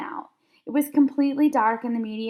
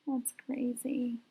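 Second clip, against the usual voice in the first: quiet aside; 9 dB quieter, slower than usual. Real, not acted. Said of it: "quiet aside".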